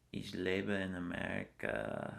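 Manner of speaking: slow, deliberate German words; careful German. Shown as a demonstration. Traveler put on the spot speaks German slowly. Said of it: "Can you say anything?".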